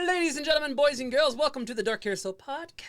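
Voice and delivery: high ringmaster announcement; big showman welcome, fast at the end; grand entrance announcement. Acted. Host's grand opening.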